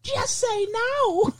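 very high, slow cartoon mascot; long-drawn high-pitched commercial character; talking Tums. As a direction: squeal out an anti-drug slogan. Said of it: "come up with an anti-drug commercial".

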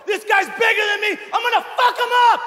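very high, frenzied mock scream; acted battle yell. Underdog boxer spitting out his mouth guard, high frenzied yell.

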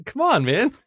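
very high, loud mock plea; high-pitched exasperated appeal, half laughing; exasperated mock protest. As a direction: plead in a hammed-up high voice. Imagined protest to the director.

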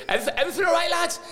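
very high-pitched retold greeting; mock manager greeting. Late manager greeting his waiting players, high mock-breezy call. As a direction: chirp it high to the whole bus.

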